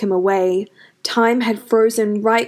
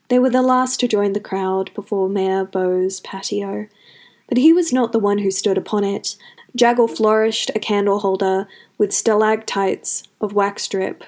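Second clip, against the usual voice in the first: usual speaking voice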